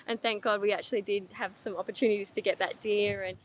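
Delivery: ordinary delivery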